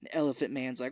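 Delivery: usual speaking voice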